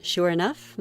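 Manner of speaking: plain reading voice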